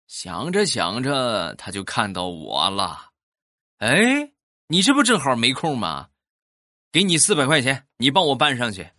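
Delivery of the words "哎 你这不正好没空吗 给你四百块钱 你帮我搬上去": much higher, louder hail; calling neighbor. Retold or imitated. Neighbor Lao Luo hailing the speaker to carry materials, loud and high. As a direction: shout over to catch him.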